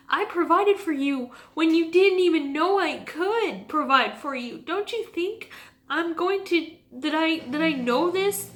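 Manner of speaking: high, loud, voice straining with feeling; emotional, near tears. Retold or imitated. Speaker tearfully voicing God's promise to trust. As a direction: push through the tears with conviction.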